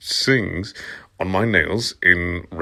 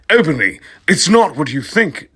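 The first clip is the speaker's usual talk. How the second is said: high, loud desperate plea; a hammed-up high wail; screamed sadly; 8 semitones higher, 4 dB louder than usual. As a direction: beg her to listen. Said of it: "Draco screamed sadly".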